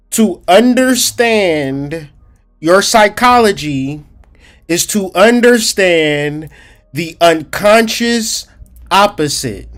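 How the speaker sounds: slow and deliberate, each phrase weighed; slowed teaching delivery to explain; slow walk it. Shown as a demonstration. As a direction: lay it down slowly like a teacher. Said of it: "I need to slow walk this".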